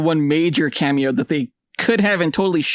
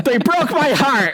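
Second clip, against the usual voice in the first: high, drawn-out comic wail; playful anguish; 13 semitones higher, 3 dB louder, slower than usual. Real, not acted. Fan lamenting to co-hosts about the cameo, melodramatically. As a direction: wail it with a laugh behind it.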